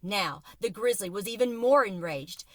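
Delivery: plain reading voice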